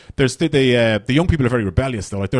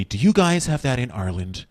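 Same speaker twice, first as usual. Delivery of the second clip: American friend; 4 dB quieter than usual. Retold or imitated. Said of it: "my American friend was going to me".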